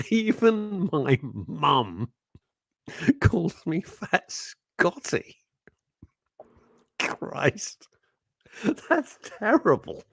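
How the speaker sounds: struggling to speak through laughter, long stalls; helpless laughter. Real, not acted. Radio host tickled by the nickname he is reading, helpless laughter. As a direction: wheeze the words out, slow and broken by giggles. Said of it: "that's completely tickled me".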